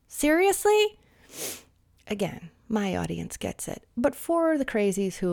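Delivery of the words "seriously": scoffing disbelief. Acted. Scoffing at mask wearers.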